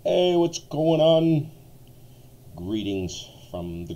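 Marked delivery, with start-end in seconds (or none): 0.0-1.5 loud drawn-out greeting. Real, not acted.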